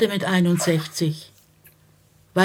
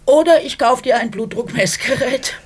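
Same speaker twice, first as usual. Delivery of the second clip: higher and louder, mock-stern; teasing threat; 8 semitones higher, 5 dB louder than usual. Wife to husband, loud teasing ultimatum. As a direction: hit back with a playful threat.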